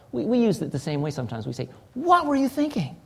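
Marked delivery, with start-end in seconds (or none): 1.9-3.0 WHAT pitched high and drawn into exasperation; a high, emphatic "WHAT were you thinking". Shown as a demonstration. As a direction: lean hard on WHAT, high-pitched disbelief. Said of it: "there's an emphasis there".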